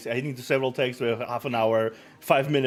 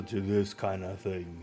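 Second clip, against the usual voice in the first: slow and much lower; dropped voice level; 4 semitones lower, 7 dB quieter, slower than usual. Shown as a demonstration. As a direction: sink into a low, slow register to show range. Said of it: "My voice is very flexible".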